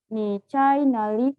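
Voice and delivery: slow, careful Mandarin example. Shown as a demonstration. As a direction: say it slowly so learners can repeat. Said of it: "Contoh yang kedua".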